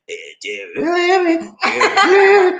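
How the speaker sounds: high mock voice rising into laughter; mocking imitation. Host teasing in a voice competition, high mock imitation. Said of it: "He's like".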